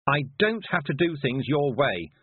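slow, defiant snarl. Acted. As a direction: snarl it back at her. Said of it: "snarled Tiffany".

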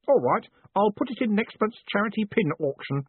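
bright, high eager voice; cheerful young clerk. A narrator's reading. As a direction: chirp it up like a keen boy. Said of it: "he went on".

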